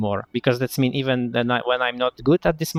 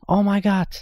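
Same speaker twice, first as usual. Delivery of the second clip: higher, quick stunned exclamation; shocked; 9 semitones higher than usual. Real, not acted. Stunned gamer blurting out his first shock. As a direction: blurt it out in disbelief. Shocked reaction after gasp.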